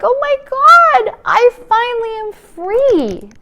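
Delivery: put-on high, loud, slow childlike joy; a freed child marvelling; joyful inner child. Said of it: "My inner child is just like".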